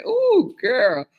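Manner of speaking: ordinary talk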